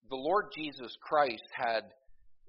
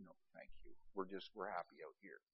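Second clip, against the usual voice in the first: hushed polite refusal; 5 semitones lower, 16 dB quieter than usual. Retold or imitated. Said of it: "We said".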